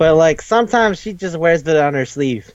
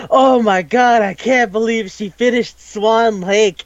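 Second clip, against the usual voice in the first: higher, slower, awed; mock awestruck; 6 semitones higher, slower than usual. Gamers amazed she finished Swan Lake, mock awestruck. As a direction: gasp it out in disbelief.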